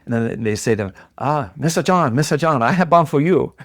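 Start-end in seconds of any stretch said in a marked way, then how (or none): 1.2-3.5 higher-pitched imitation of a Chinese shopkeeper's accent; imitated Chinese-accented English; mock Chinese accent. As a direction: call out a greeting in a put-on Chinese accent. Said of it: "I apologize for my accent".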